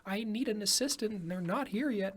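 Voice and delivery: high-pitched voice of a caller needing help; nursing home caller. Retold or imitated. Nursing home client phoning the receptionist, high imitated voice. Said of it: "my nursing home people call in".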